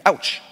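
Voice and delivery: sharp, high "Ouch!" like a bumped head; mock ouch. American bumps his head on a walk, yelps a high "Ouch!". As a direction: yelp "Ouch!" as if you just hit your head. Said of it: "his initial reaction might be".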